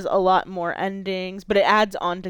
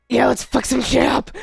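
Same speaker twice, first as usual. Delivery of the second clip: loud, growled demon battle cry; gruff demon shout; 5 semitones higher, 8 dB louder than usual. Shown as a demonstration. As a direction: roar it like a hellish demon boy. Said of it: "Like, like that".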